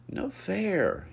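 high, loud protest; huffy. A narrator's reading. Lucas thwarted in the pool, huffing. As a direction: huff it like a sulk. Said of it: "he huffed".

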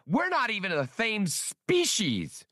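high, slow, outraged squeaky voice; exploding. A narrator's reading. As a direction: blow up at the waiter, indignant.

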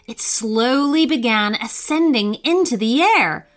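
usual speaking voice

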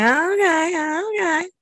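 high squeaky okay; mock okay. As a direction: squeak it out nervously. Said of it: "I'm like".

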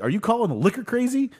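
put-on high, fast indignant drunk; drunk Leahy voice. Leahy answering his critics, high indignant drunk voice. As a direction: bristle like an offended drunk. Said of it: "and he's like".

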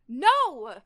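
loud, sudden acted shout; protective shout. Reyes stepping in front of the boy, shouting. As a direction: shout it to stop an attacker.

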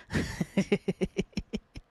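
short run of chuckles; amused chuckle. Real, not acted. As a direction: chuckle at your own silly plan. Laughs at his hat plan.

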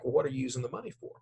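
fast probing questions; quick questions. Retold or imitated. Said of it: "those other questions look like".

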